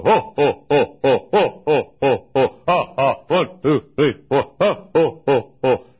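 loud, punchy ho ho ho voice exercise; vocal warm-up. Shown as a demonstration. Preacher showing his daily voice exercise, loud rhythmic hos. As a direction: bark each ho out from the belly like a morning drill. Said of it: "every morning I get up and I go".

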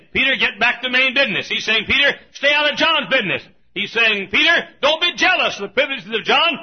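high, loud preacher's scolding; full-force pulpit rebuke, pitched high; shouted rebuke. Acted. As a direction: holler the correction at Peter. Preacher voices Jesus rebuking Peter.

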